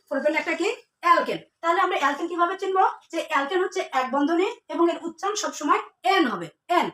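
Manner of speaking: ordinary talk, nothing marked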